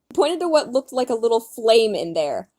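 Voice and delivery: usual speaking voice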